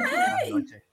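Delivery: high, slow lady greeting; posh lady voice. Pretend. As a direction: greet like a fancy English lady.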